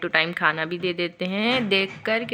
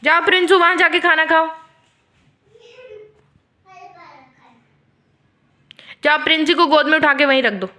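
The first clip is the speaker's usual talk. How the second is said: calling out; 7 semitones higher, 6 dB louder than usual. Real, not acted. Mother calling pet away loudly.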